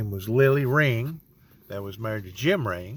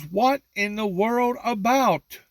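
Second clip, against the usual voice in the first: puzzled mother; 10 semitones higher, 3 dB louder, slower than usual. Retold or imitated. Mother's puzzled question, retold.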